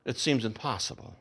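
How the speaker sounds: normal talking voice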